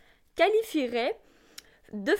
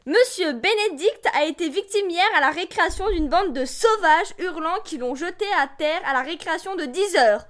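loud, higher-pitched indignant parent; outraged complaint; 4 semitones higher, 9 dB louder than usual. Acted. Parent reporting a playground attack to the school, loudly outraged. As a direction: let the outrage ring out at full voice.

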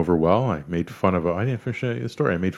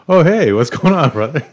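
startled greeting; 8 semitones higher, 7 dB louder than usual. Retold or imitated. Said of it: "I'm like".

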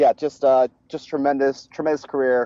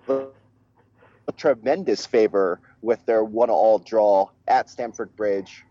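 ordinary talk

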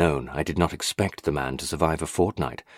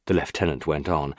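plain reading voice

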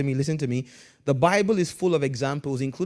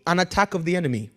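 normal talking voice

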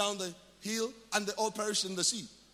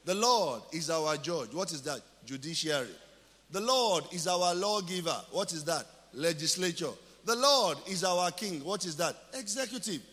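sermon call and response; 4 semitones lower than usual. Acted. Preacher quizzing the congregation, measured preaching. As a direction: preach it as a teaching litany.

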